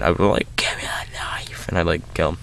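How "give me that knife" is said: hushed, unhinged demand, pretend; mock crazed whisper. Viewer imagining revenge on the killer, pretend crazed whisper. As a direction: whisper it as if going insane. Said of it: "I would be going insane".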